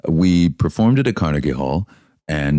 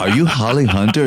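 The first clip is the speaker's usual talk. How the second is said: higher, louder excited retake; 7 semitones higher, 3 dB louder than usual. Retold or imitated. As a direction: pitch "Are you Holly Hunter?" up, louder and starstruck. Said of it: "I know it sounded like Liza".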